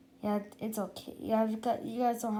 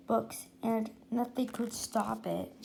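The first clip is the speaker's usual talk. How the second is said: usual speaking voice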